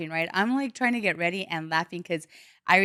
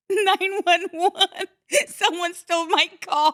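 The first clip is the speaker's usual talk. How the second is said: high, slow, loud emergency call; retold panic; 10 semitones higher, 5 dB louder than usual. Caller telling emergency line her car is stolen, mock panic. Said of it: "So I'm like".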